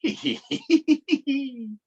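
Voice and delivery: high giggle. A narrator's reading. Polly laughs at Froggy falling, high giggle. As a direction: giggle like a little girl. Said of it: "Giggled Polly".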